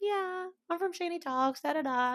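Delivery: mock diva, lightly; celebrity brush-off. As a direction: play the famous star. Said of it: "I'm gonna be like".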